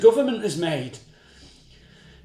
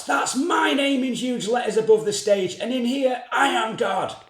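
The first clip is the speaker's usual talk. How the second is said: a higher, half-raised boast; [name]'s mock shout; 5 semitones higher than usual. Host boasting to the impertinent boy, mild mock shout. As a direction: proclaim yourself god of the stage. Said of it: "[name] shouts".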